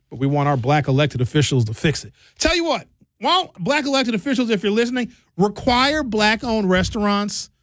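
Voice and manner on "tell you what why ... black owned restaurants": fired-up demand. Real, not acted. Appeal to officials.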